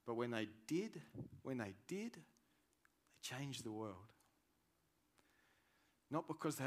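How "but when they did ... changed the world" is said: ordinary talk, nothing marked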